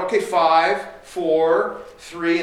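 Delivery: loud warning count, each number set apart; imitated parental countdown. Parent starting the countdown at an inattentive child, loud mock warning.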